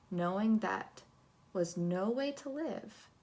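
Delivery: ordinary delivery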